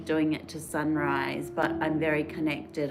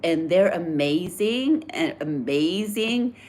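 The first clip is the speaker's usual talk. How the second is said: put-on American accent; 2 semitones higher, 4 dB louder than usual. American fan introducing herself on the beach, imitated American accent. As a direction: put on her American accent, eager and friendly. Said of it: "I'm gonna try and do her American voice".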